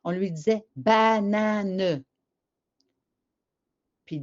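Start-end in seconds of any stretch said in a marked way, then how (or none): 0.8-2.0 "banane" drawn out in three syllables, ba-na-neu; written-syllable pronunciation. Shown as a demonstration. Teacher dictating to a child, "banane" drawn out with final "neu". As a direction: stretch "banane" slowly, voicing the final E as "neu". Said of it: "et non pas banane".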